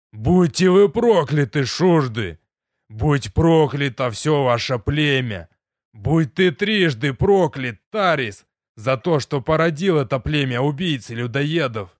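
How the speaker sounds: loud, slow roaring curse; furious yelling. Acted. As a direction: bellow it at the sky in rage. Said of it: "и я начал кричать".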